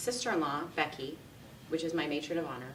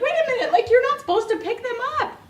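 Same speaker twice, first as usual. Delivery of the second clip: much higher, louder startled protest; amused alarm; 11 dB louder, faster than usual. Retold or imitated. Bride watching the flower girl pick up petals, startled. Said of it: "I'm like".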